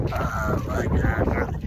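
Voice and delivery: slow, visceral throat sound; back-of-throat voice. Shown as a demonstration. Showing the voice that talks through him, throaty and slow. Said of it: "visceral kind of in the back of your throat".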